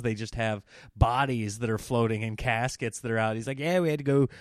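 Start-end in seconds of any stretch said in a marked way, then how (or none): 3.6-4.3 a lightly done, bad Louisiana bayou accent; bad Cajun accent. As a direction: put on a clumsy Cajun boat guide accent. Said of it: "On the horrible accent".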